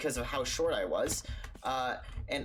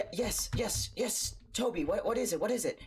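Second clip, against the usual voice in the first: anxious dad; 7 semitones higher than usual. Retold or imitated. Acting dad anxiously answering child.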